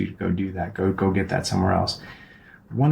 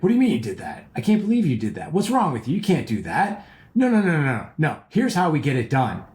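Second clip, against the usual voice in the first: higher, pushy scolding, mock disbelief; domineering, in your face; 6 semitones higher than usual. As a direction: lecture him like a bossy know-it-all. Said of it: "overpowering, over domineering, in your face".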